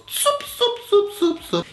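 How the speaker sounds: swooping high sing-song soup soup; playful high-pitched sowing noises; sowing sound. Retold or imitated. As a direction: toss out each soup like flinging seed. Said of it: "He goes".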